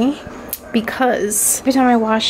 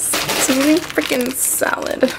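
ordinary talk, nothing marked

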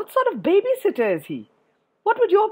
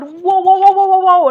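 high, loud rapid whoa-whoa-whoa; frantic swimming noise; 12 semitones higher, 11 dB louder than usual. Acted. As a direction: sound out a furious dash through water. Acts out frantic swimming to the shark.

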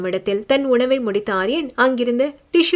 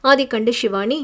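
ordinary delivery

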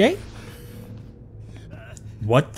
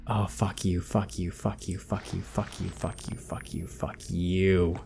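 repeated curse trailing off softer and softer; fading curse; 5 semitones lower, 9 dB quieter, much slower than usual. Real, not acted. Gamer cursing the game under breath, fading to a whisper. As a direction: mutter it away to nothing.

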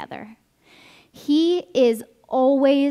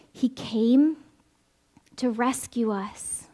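whisper; slower than usual. Acted. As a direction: hush the words for weight. Sermon, hushed emphasis.

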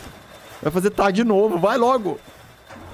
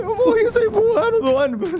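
panicking gamer; 3 dB louder than usual. Real, not acted. Gamer dies, flung from bus.

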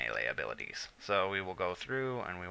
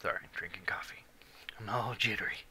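whispered aside; breathy jittery whisper; 9 dB quieter than usual. Real, not acted. Whispered aside about coffee.

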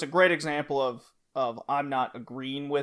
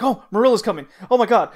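high, loud mock panic as Marilla comes; 7 semitones higher, 9 dB louder, faster than usual. Anne panicking as Marilla approaches, high, loud mock alarm. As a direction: squeal in alarm that Marilla is coming.

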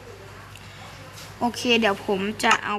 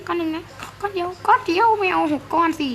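high pleading; 7 semitones higher than usual. Acted. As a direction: pitch up and plead for just one. Begging villager for one item. (อ้อนขอเสียงสูง)

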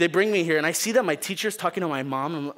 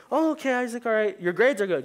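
mom, trusting; 7 semitones higher than usual. Retold or imitated. Mom voiced in story.

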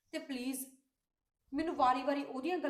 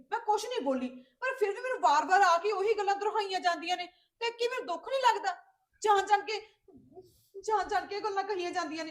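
higher and louder, hurt and indignant protest; angry outburst; 7 semitones higher, 7 dB louder than usual. Acted. Sister confronting brother about the sister-in-law's advice, angry hurt outburst. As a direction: let the grievance burst out at him.

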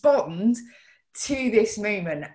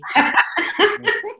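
burst of laughter; 12 semitones higher, 3 dB louder than usual. Real, not acted. Laughing at own outburst.

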